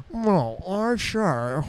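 mumbled toothy cartoon voice; Nigel Thornberry. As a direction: badly impersonate a garbled cartoon Brit. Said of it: "terrible impersonation of him".

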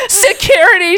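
high, loud call for help; hammed-up panicked call; panicked cry. A narrator's reading. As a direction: cry out into the phone. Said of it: "she cried into the phone".

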